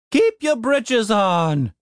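slow, drowsy grumble; just woken up, slow; sleepy voice. Acted. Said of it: "said a sleepy voice".

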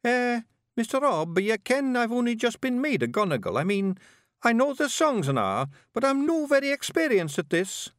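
put-on high boyish Scots, thinking hard; young Scottish pictsie bard. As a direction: ponder it like a nervous young bard. Billy unsure.

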